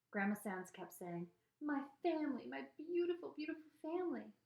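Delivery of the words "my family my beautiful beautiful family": high, tearful granny overcome by family; acted weepy Southern grandmother; Southern-accented weeping. A narrator's reading. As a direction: wail it lightly in a Southern accent. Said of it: "Southern style accent and all the weeping".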